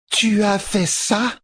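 high, very slow accusation; Tuon accusing. A narrator's reading. Empress rounds on Matt, accusing. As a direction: draw the question out high, as an accusation.

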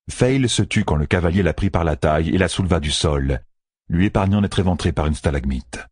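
usual speaking voice

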